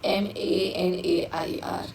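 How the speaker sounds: slow, soft letter-by-letter spelling; dictating the spelling of मानेर to students, slowly and softly; spelled out letter by letter. Shown as a demonstration. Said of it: "जिसको कहते हैं हम लोग मानेर".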